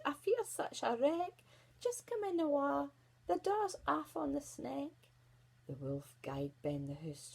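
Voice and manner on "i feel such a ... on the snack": put-on high-pitched old woman's voice; old granny. A narrator's reading. Granny sick in bed invites visitor in, old voice. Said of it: "An old voice called out".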